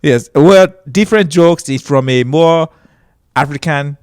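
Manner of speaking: newly arrived Nigerian immigrant's accent. Shown as a demonstration. Comedian posing as a newcomer from Africa, Nigerian accent. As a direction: keep up a put-on Nigerian English. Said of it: "I'm talking just like this".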